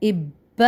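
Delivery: "اب" as ibba, the double b held then released; geminated ib-ba for "اب"; pressed doubled consonant. Shown as a demonstration. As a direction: lean on the b of "اب" after a short i. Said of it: "زور لگا کے".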